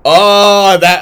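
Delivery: loud, high "oh" of recognition; sudden realization. Real, not acted. Reacting to co-host's reminder about the car, loud realization. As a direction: burst out as the memory hits. Suddenly recalls what they did.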